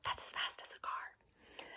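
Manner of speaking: breathy whisper tossed in; hushed aside to the class. Real, not acted. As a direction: lean in and whisper it like a secret. Aside to the kids mid-reading.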